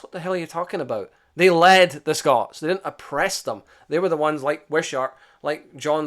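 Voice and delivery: exasperated rebuttal; indignant outburst. Real, not acted. Indignant rant at critics.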